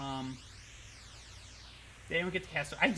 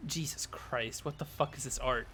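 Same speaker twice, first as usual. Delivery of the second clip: ordinary talk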